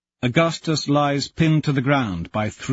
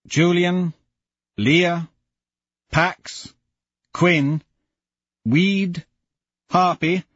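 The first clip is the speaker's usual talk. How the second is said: name the dead one by one, mourning; grieving roll call; 6 semitones higher than usual. Acted. Listing the fallen friends aloud.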